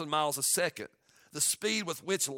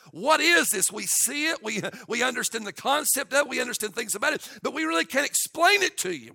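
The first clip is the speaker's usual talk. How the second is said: rising, louder preacher's voice; preaching at full force; 5 semitones higher, 5 dB louder than usual. Acted. Pastor stirring his church to awe, loud and climbing. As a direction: build the fervour line by line.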